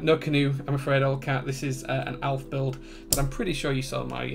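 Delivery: ordinary talk, nothing marked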